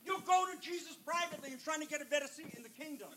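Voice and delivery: high-pitched indignant mock praise, dropping very soft; sarcastic indignation. Disciples to James and John over their request, sarcastically indignant. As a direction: grumble sarcastically in a high voice, trailing into a hush. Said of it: "they became indignant with James and John".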